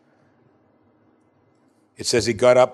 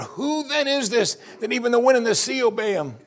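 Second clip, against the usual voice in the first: much higher, put-on amazed question; frightened awe; 11 semitones higher than usual. Terrified disciples to one another after the calm, acted awe. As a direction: cry it out in hammed-up awe. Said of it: "They became very much afraid".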